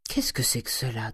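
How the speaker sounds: usual speaking voice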